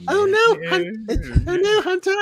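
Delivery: put-on high little girl's voice; Omega voice. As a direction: squeak it out like a young girl. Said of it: "But his Omega".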